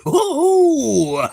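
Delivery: high, loud whooping hoo-hoo. Retold or imitated. Recalling the [name] bit, high whoop.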